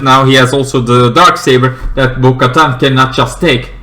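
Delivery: normal talking voice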